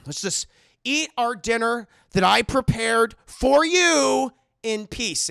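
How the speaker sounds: slow, loud, high-rising insistence; acted strained demand; exasperated Howard. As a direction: drag out each phrase, swelling in anger. Acted exasperated host at dinner.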